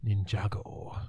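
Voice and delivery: whispered Ninjago to himself; whispering. Shown as a demonstration. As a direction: whisper the word, trying it out. Whispered test of the word.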